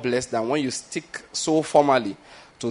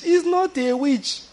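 high, slow, drawn-out mock protest; mock exclaim; 14 semitones higher, much slower than usual. Shown as a demonstration. Preacher mocking the crowd's idea of a witch, high and slow. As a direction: stretch it out high in pretend disbelief. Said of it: "Believe me, he's not a witch".

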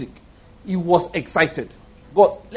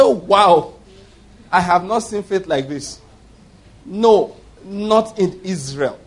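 excited; slower than usual. Retold or imitated. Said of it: "The Lord was excited".

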